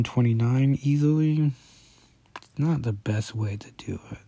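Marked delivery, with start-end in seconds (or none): 2.4-4.2 breathy unvoiced aside; whisper. Real, not acted. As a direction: whisper it to yourself. Aside muttered while searching.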